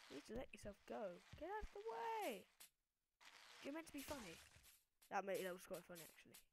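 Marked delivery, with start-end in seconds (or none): 1.3-4.4 cartoon shout to clear the way; high silly shout. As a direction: squeal it out drawn long. Shouting at character blocking.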